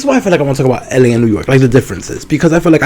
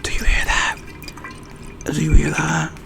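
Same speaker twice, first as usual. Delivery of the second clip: soft ASMR whisper close to the mic; whispered ASMR demo; 6 dB quieter than usual. Pretend. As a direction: hush right down and tease the sound. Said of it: "The A S M R".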